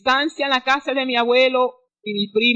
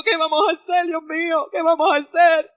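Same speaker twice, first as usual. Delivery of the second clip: higher, pleading panic; retold panic; 4 semitones higher than usual. Daughter begging her mother for help, panicked pleading. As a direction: ask what to do, lost and scared. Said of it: "Y yo ahí en pánico".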